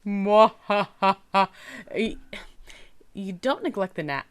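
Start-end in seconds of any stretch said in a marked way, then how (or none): 0.0-1.5 slow sneering villain cackle; evil laugh. Pretend. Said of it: "have you practiced your evil laugh?".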